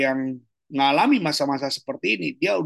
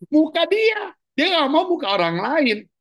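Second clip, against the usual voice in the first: much higher, louder mock disbelief; mock astonishment; 12 semitones higher, 3 dB louder than usual. Teacher mocking sanguine people who only post their own face, high mock disbelief. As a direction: squeal it as if it's outrageous. Second take, mock outrage.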